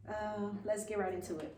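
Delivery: normal talking voice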